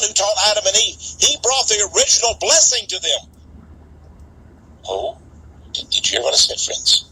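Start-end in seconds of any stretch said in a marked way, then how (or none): none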